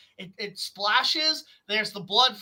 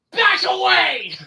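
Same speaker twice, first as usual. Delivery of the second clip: louder, higher and slower warning yell; mock shout; 6 semitones higher, 6 dB louder, slower than usual. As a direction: bark the order to step back. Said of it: "He's like".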